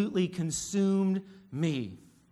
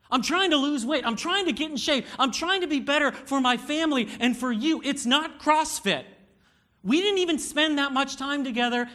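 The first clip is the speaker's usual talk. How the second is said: high, raised-voice defensive outburst; inflamed; 5 semitones higher than usual. Retold or imitated. Husband defending his workouts to his wife, inflamed. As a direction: fire back heatedly, each point pitched high. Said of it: "from irritation to inflamed".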